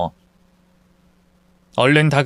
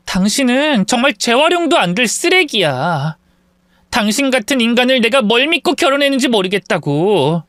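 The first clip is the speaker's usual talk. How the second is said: contemptuous; 6 semitones higher than usual. Acted. Wife scorning husband.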